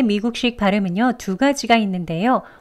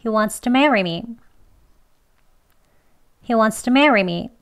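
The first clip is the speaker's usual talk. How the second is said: He wants to marry me, s-linked, quicker; a bit faster; much slower than usual. Shown as a demonstration. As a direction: move it along, stress on marry. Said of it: "조금 빨리 할게요".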